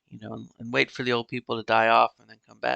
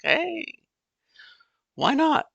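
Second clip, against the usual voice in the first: very high, louder playful why not; hammed-up carefree yes; mock eager acceptance; 4 dB louder, slower than usual. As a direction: toss it off with giddy glee.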